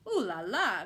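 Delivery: flustered outcry; alarmed cry. A narrator's reading. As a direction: exclaim in shock. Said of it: "cried Madame Pinson".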